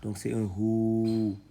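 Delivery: the vowel "ou" held long and slow; lengthened ou. Shown as a demonstration. Teacher showing students the lengthened "ou" slowly. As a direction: stretch out "ou" to show the lengthening. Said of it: "sert d'outil d'allongement".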